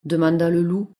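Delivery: ordinary delivery, nothing marked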